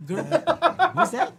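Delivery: laughing. Real, not acted. Laughing at the search result.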